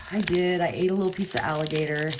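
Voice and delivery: ordinary delivery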